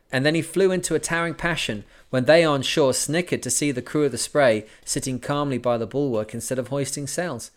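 steady, even audiobook reading; story narration. A narrator's reading. Podcaster reading a sailing memoir to listeners, measured narration. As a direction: read the voyage tale to listeners. Reads the book aloud.

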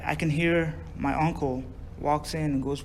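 ordinary delivery

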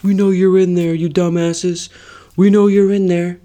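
loud barked police yell; cops shouting. Retold or imitated. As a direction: shout it like an angry cop.

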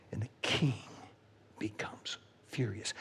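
hushed, breathy whisper; dramatic whisper. A narrator's reading. Preacher whispering the king's fury. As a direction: drop to a whisper for effect. Climax of story.

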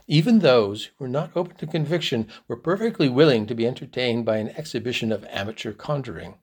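usual speaking voice

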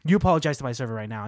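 quick, insistent retold order; firm confrontation, rattled off with force; forceful stern demand. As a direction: press the demand hard and fast. Acted demand repeated with more force.

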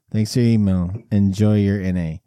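deadpan it like a form letter; deep canned reply voice. Retold or imitated. Repeats it, lower still.

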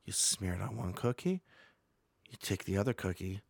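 low, hushed. Pretend. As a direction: lean in and drop to a hush. Hushed recipe steps.

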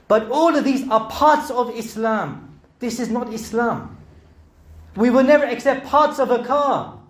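high, loud fervent sermon; preaching at full force. Acted. Preacher hammering the car analogy home, loud and fervent. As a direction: drive the point home hard.

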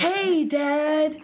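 practicing how to say hey dad; practicing a greeting. Retold or imitated. Woman rehearsing a casual hello to her dad. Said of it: "Practice voices and everything".

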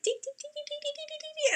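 very high, rapid tinks; tiny squeaky run of cracking sounds; imitated tinking. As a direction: imitate a fast, high ticking. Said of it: "like that sound".

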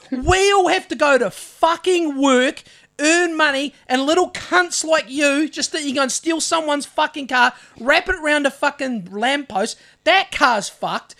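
heated, emphatic retold rant; retold laying into someone. Storyteller lecturing the car thief before everyone, retold angry rant. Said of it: "I just started laying into him".

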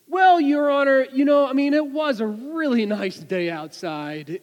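an easygoing cop pleading leniency; mock officer telling the judge about the nice day; police officer. Officer speaks up for the driver.

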